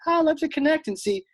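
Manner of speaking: high, sugary mock politeness; fake sweet. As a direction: put on a syrupy, too-nice voice. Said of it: "but I was gonna be like".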